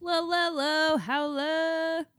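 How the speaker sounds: high, drawn-out howling call; howled greeting. Acted. Cohost howls hello to the listeners. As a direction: howl the greeting like a wolf.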